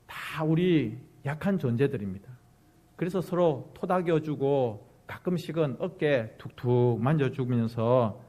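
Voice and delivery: ordinary talk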